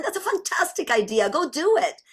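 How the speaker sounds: high, quick encouragement; childlike excitement. Acted. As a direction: cheer a friend on like an eager kid. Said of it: "what does a child sound like?".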